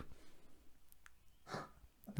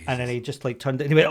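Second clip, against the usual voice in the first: ordinary talk, nothing marked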